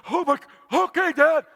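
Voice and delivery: high, gasping compliance; startled kid. Retold or imitated. Son agreeing to his shouting dad, startled. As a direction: gasp it like an overwhelmed child.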